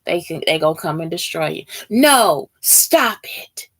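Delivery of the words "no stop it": emphatic, drawn-out refusal; firm mock scolding. Imagined bullies take away good news, mock scolding. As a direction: put your foot down, firmly.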